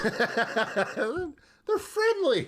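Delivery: high, very slow and laughing, sarcastically sweet; laughing sarcastic drawl; mock euphemism. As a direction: stretch it out high like a cheery cover story while laughing. Mocking a euphemism for colonial powers.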